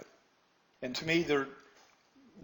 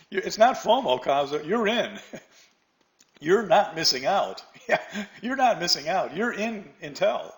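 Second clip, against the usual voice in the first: amused and louder, laughing through it; laughing reply; 4 semitones higher, 5 dB louder than usual. Real, not acted. Teacher laughs while correcting a student's fomo answer.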